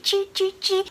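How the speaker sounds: high, soft mock clank noises; playful high clank sounds for cute feet; imitated clanking footsteps. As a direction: squeak out the robot's little metal steps. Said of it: "Listen to his cute clanking feet".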